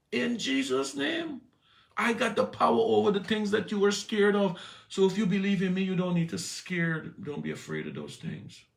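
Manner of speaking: high, loud preaching with a shout; full-force preaching. Acted. Preacher telling the church Jesus has power, preached full force.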